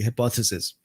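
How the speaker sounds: ordinary delivery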